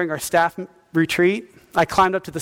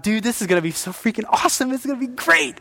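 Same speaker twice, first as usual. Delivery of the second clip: mock excitement; 5 semitones higher than usual. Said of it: "I think about".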